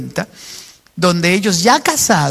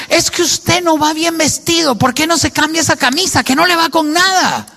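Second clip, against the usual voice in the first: higher, lightly voiced scolding wife; nagging wife; 7 semitones higher than usual. Wife scolding her husband about his mismatched shirt, mildly mocked. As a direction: fuss over his clothes.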